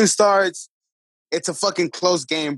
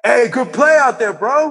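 sarcastic fan; 6 semitones higher, 4 dB louder than usual. Retold or imitated. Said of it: "this Bishop Lynch fan was just like".

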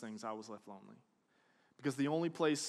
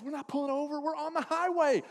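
higher-pitched protest; protesting dad; 9 semitones higher than usual. Retold or imitated. Dad driving, arguing with mom, high protest. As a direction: object in a raised pitch. Dad objecting in acted quarrel.

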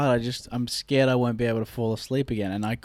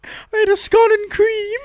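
laughing; 5 dB louder than usual. Acted. Laughing, high.

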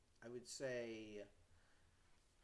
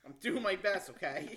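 loud laughing protest; 8 semitones higher, 13 dB louder than usual. Real, not acted. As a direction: laugh it out loudly. Jokey protest after being scolded.

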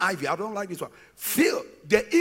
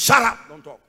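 mock stern hush; 5 semitones lower, 7 dB louder than usual. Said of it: "he means".

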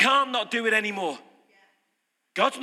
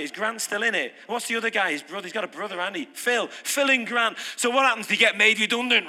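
usual speaking voice

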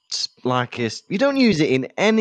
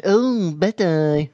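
slow, drawn-out catchphrase in a put-on voice; higher, slow mock sitcom character; sitcom impression; 4 semitones higher, much slower than usual. Co-host compares the boy to a sitcom, slow put-on voice. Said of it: "sounds like some mothers do have 'em".

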